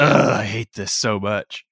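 slow, exasperated groan of mock frustration; annoyed ugh. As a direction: drag out the ugh, half-joking annoyance. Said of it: "really hard to sit there and go".

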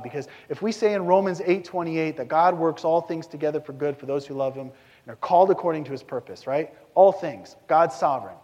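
ordinary talk, nothing marked

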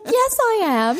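very high mock British accent; bad British accent. Friend insisting she is from London, bad British accent. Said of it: "your accent sounds terrible".

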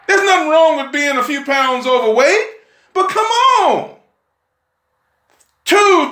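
high-pitched, incredulous preacher challenge; worked-up pulpit exhortation; fiery preaching. Real, not acted. Preaches at full force.

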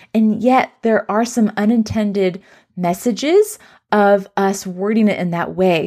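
ordinary talk

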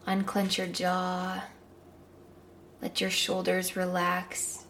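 calm guided meditation. Acted.

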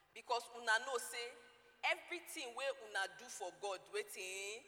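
rally the church in Pidgin; fiery Pidgin English sermon; Nigerian Pidgin preaching. Acted. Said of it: "Pidgin English version".

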